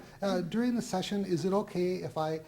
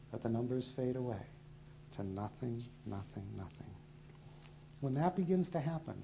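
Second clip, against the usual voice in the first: hypnotist induction; 4 semitones lower, 5 dB quieter than usual. Acted. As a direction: lull them down gently and steadily.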